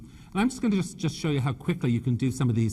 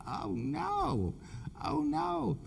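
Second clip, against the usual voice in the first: mock horror; 13 semitones higher, 8 dB quieter, much slower than usual. Reacting in horror to the suggestion.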